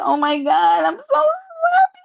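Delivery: hammed-up happy sobbing; fake-cry it like a mock sob. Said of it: "the way that I cried".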